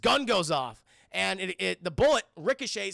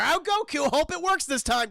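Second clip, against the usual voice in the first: mock hopeful crowd; 7 semitones higher, 5 dB louder than usual. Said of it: "And they're like".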